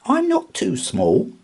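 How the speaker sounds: high, loud, indignant mouse; huffy mouse. A narrator's reading. Little mouse huffs at Fox, high and loud. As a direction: huff it back, offended. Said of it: "huffed Milly".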